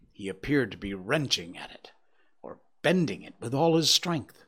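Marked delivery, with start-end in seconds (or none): none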